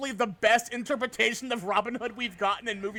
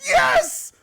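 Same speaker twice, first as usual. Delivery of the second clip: high, loud joyful shout; happy yell; 10 dB louder than usual. Retold or imitated. Streamer reliving being recognized in cosplay, joyful shout. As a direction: burst out with delight. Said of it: "I was so excited".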